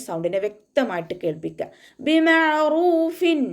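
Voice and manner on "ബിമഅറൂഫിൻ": slow, high, drawn-out Quranic chant; measured tajweed recitation. Shown as a demonstration. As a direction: recite it in tajweed, stretching each sound. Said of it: "വ്യക്തമായിട്ട് കേൾപ്പിക്കും ചെയ്യുക".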